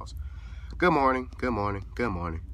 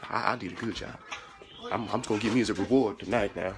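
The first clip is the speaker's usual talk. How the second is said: confiding servant; 2 semitones lower than usual. Pretend. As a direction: confide in the audience behind the boss's back. Servant confides in audience secretly.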